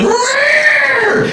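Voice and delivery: very high shouted whinny; high shout like a rearing horse; horse rear cry. Retold or imitated. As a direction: make the horse screech rear. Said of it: "He went".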